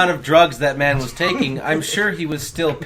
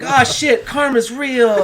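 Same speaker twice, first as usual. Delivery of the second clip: higher, louder acted dismay; mock alarmed realization; 9 semitones higher, 5 dB louder, slower than usual. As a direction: play the character's sudden horrified realization, laughing. Voices the character's imagined sudden realization.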